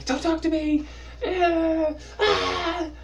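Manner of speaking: very high squealed outburst; hammed-up high wailing; mock tantrum. Periodically blowing up at the crush, squealed mock tantrum.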